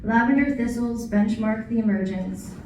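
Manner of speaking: projected, measured verse with line breaks; poetry reading. Acted. Poet reading an autumn poem to an audience, poetry reading. Reads next poem aloud.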